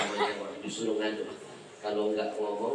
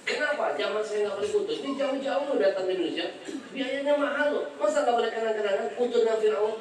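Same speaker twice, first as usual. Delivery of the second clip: higher, louder complaining voice; indignant protest; 13 semitones higher, 5 dB louder than usual. Retold or imitated. Preacher complains to the airport officer, higher and indignant. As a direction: argue back, outraged. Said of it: "saya tanya".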